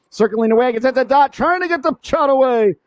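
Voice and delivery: high, urgent call of the attack; excited play-by-play. Real, not acted. Live call of pressure near goal.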